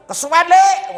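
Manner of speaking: high, loud mock complaint; mimicking the audience. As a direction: whine it like a bored listener. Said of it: "ngono to kowe".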